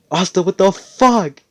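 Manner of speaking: put-on high, loud jealous shriek of a girl; jealous outburst. Mimosa sees Asta kiss Noelle, shrill jealous outrage. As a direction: let her jealousy burst out high and loud. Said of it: "but she's like".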